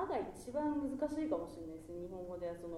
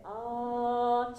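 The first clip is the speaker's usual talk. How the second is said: あー lifted slightly upward, louder; 7 dB louder than usual. Shown as a demonstration. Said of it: "ちょっとだけ上、上向きのあー". (天井の高い上向きのあー)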